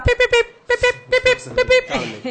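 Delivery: high beeping pip pip, laughing at the end; playful high-pitched beep imitation; news alert beeps. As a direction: mimic a breaking news jingle in a high squeak. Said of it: "Chugui tiene una noticia de última hora".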